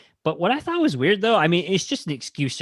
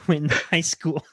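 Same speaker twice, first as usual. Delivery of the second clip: ordinary delivery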